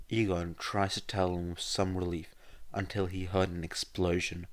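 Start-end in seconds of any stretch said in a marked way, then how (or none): none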